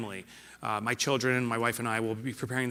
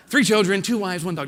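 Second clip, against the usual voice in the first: laughing slip; 8 semitones higher, 10 dB louder than usual. Shown as a demonstration.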